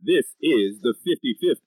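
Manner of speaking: slow grand announcer intro. Acted. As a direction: draw it out like a showman. Podcast host opening announcement.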